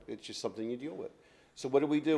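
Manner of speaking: usual speaking voice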